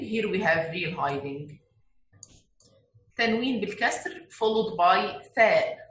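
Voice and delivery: ordinary talk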